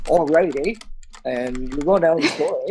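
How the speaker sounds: put-on broad hoser Canadian accent with eh; Bob and Doug accent. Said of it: "when they did it on Bob and Doug".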